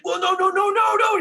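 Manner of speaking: high, loud panicked no no no. Retold or imitated. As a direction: yelp it in a frantic high voice. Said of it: "He, like, panics".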